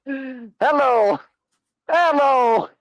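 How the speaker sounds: high, teasing hello; mock call. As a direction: call out in a squeaky put-on voice. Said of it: "he's like".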